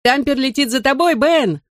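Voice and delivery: high, excited call upward. A narrator's reading. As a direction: call to a friend in the air. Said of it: "крикнул ему я".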